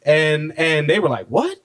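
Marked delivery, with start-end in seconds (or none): 1.3-1.6 high-pitched mock disbelief; incredulous hosts. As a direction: imitate a scandalized "what?", pitched way up. Retelling hosts' incredulous reaction.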